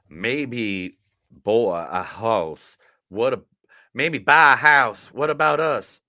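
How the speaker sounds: hammed-up Texas drawl, shouting at the end; broad Southern twang, building to a yell; Southern accent. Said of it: "the Southern accent for every single quote".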